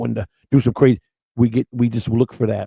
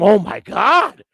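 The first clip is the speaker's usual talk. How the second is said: drawn-out, higher mock "Oh my God"; mock moan; 9 semitones higher, much slower than usual. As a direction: ham up a slow, swooning "Oh my God". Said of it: "you'll see 'em".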